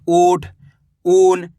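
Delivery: long first vowel in "ऊँट" and "ऊन"; held "ऊ" opening "ऊँट", then "ऊन"; drawn-out vowel. Shown as a demonstration. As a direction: stretch the "ऊ" of "ऊँट" and "ऊन". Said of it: "इसको खींच के पढ़ना है".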